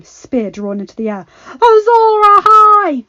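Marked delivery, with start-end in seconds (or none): 1.5-3.0 much higher, louder, slow roar. Acted. Jaime raising his sword, roaring. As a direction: roar it at full force. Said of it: "roared Jaime Lannister".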